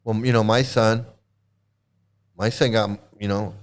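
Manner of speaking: imitating him in a low, offhand voice; mock nonchalance. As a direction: mimic his casual brush-off, pitched well down. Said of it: "That's exactly how he said it".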